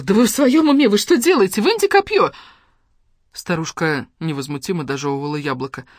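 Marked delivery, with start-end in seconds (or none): 0.0-2.3 shrieking outrage, very high and loud; pained, panicked shriek; screaming in pain. A narrator's reading. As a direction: scream as if stabbed. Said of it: "захлебнулась от боли и закричала".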